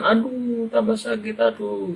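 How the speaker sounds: put-on hurt boy's cries of pain; child in pain. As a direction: yelp like a kid as it's pulled. Stinger pulled out, pain.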